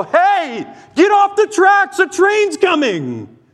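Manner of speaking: put-on yelling at a child to get off the railroad tracks; urgent yell. Shown as a demonstration. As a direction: scream the warning as the train bears down. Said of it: "would you demand that I yell".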